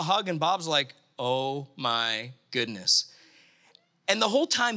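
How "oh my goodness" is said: low, slow, stunned murmur; stunned realisation. Retold or imitated. Bob realising the ambassador is real, stunned realisation. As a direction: let it sink in slowly, pitched low.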